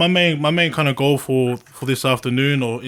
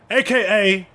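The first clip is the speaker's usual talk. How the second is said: drawn-out announcer intro, slow and raised; hype announcer; 7 semitones higher, slower than usual. Acted. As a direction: announce the nickname like a ring announcer.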